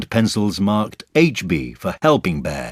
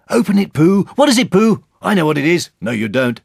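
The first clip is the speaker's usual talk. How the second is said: high, loud, overlapping-style chatter; excited clamour; 12 semitones higher, 8 dB louder than usual. A narrator's reading. Friends crowding round Pooh's present, excited clamour. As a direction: tumble out eager cries.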